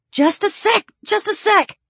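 high, hurried-sounding reply; frantic, high-pitched stalling; flustered urgency. Acted. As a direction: answer in a fumbling panic. Flustered, fumbling with the lace.

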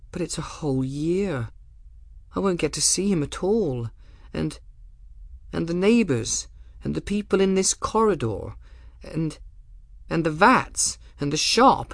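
put-on a girl close to tears, pleading; young girl voice. A narrator's reading. Bridget's tearful pleading.

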